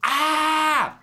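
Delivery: loud, high drawn-out yell; shouted scream. Acted. Co-host breaking into the song's shout, loud high yell. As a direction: burst out with one long high shout. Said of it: "I could have shouted there".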